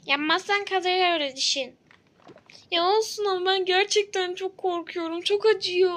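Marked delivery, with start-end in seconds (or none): 2.7-6.0 terrified patient sobbing in the chair; frightened crying. Acted. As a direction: beg through tears not to be touched. Scared patient begging dentist.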